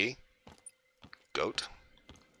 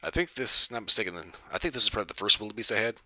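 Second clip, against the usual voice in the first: ordinary delivery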